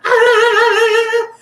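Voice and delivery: high, loud, sustained shriek; hammed-up attack scream; imitated scream. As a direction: mimic her screaming, piercing and loud. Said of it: "starts screaming".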